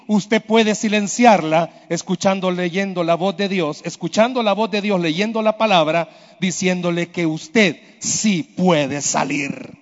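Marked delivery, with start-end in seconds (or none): none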